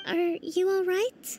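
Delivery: ordinary delivery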